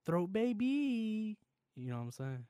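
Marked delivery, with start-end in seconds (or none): 0.0-1.4 slow, sing-song catchphrase; drawn-out slow. Pretend. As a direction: stretch it slowly and higher. Drawn out comment for viewers.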